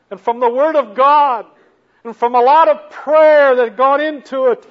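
building from firm to loud preaching; rising preaching. Real, not acted.

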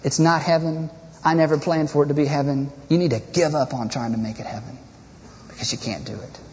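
low, soft voice of God answering; God's calm reply. Acted. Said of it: "God says".

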